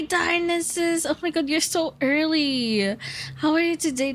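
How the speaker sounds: ordinary talk, nothing marked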